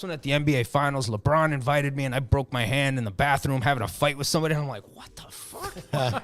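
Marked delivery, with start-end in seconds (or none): 4.9-6.2 slow, drawn-out disbelief; stunned disbelief. Retold or imitated. Storyteller reacts to the bathroom fight story, stunned disbelief. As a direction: stretch it out, incredulous.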